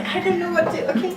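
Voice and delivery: half-laughed retold protest; laughing. Owner laughing as she retells the wedding shoot. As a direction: say it while laughing helplessly.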